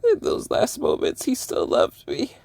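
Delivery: breathy high whisper through tears; tearful whisper. Real, not acted. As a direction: barely get it out, crying. Tearful grief over lost cat.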